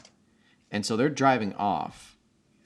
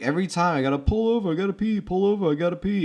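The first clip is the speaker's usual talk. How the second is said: high, fast, whiny griping; mock-whine about stopping to pee; whiny complaint; 8 semitones higher, 4 dB louder, faster than usual. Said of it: "It's like".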